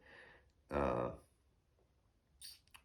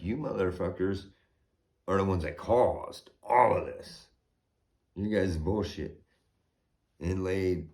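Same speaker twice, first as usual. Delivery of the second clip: retold angry tirade; 3 semitones higher, 5 dB louder than usual. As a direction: tear into them with real heat.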